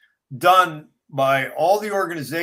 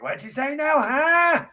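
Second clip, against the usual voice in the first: mock hard of hearing; 10 semitones higher than usual.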